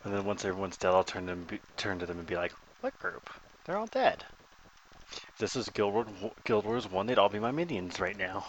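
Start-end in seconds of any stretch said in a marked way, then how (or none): 2.8-4.2 mock innocence. Imagined gloating reply to the yeller.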